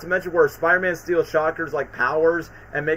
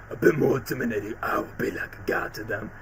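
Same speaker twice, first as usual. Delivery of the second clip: low, hushed, menacing whisper; intimidating villain voice; 7 semitones lower, 6 dB quieter than usual. Retold or imitated. The imagined Electro voice the vlogger wanted, hushed menace. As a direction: threaten like a would-be god. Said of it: "I thought it's gonna be sound like".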